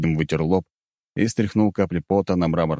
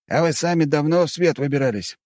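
mocking; 10 semitones higher than usual. A narrator's reading. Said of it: "насмешливо спросил Вадим".

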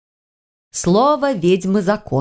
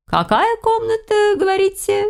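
high-pitched query. Acted. Witch asks for the room number.

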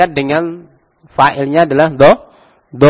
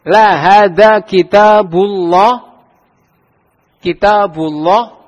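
slow, drawn-out example sentence; slow teacher demo; slow model reading; 4 semitones higher, much slower than usual. Shown as a demonstration. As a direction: spell it out slowly for students.

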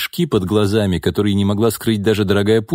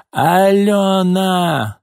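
put-on long, much higher, slow drunken yell; drawn-out yell; 10 semitones higher, 3 dB louder, much slower than usual. A narrator's reading. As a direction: stretch the name out in a wailing shout. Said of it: "снова крикнула Нина".

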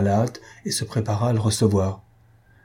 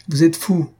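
dismissive retort; 7 semitones higher, 5 dB louder than usual. Acted. Countess retorts to the baron.

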